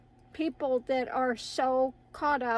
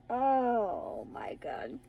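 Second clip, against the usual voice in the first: whispered aside; much slower than usual. Real, not acted. Tickled aside after dog mishap.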